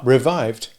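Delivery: plain reading voice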